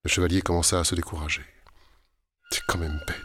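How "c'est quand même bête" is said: lightly voiced sulky knight; a hint of a whiny grumble; complaining knight. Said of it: "se plaignit-il". (léger ton plaintif du chevalier)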